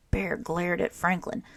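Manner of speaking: ordinary delivery, nothing marked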